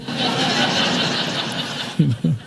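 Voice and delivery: a quiet airy chuckle; amused breathy chuckle; soft breathy laugh. Real, not acted. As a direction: laugh at yourself under your breath. Laughs at his own story.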